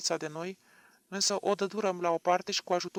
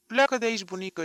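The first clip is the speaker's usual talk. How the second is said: louder, higher order to leave, full of hostility; hostile; 5 semitones higher, 9 dB louder, faster than usual. A narrator's reading. Doctor ordering the grandmother out, hostile and loud. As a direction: throw the old woman out with hostility. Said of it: "i-am zis eu cu dușmănie".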